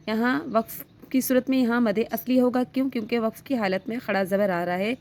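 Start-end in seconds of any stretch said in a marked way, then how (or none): none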